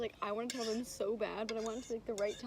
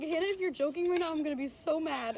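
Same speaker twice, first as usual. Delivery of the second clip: higher, louder imitated warning; excited mock threat; 6 semitones higher, 6 dB louder than usual. Woman quoting her sister's reaction to news, excitedly disbelieving. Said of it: "she was so excited".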